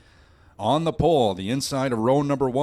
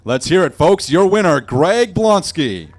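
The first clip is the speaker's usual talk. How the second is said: crowd hype; 4 semitones higher, 7 dB louder than usual. Real, not acted. Announcer hypes the winner to crowd.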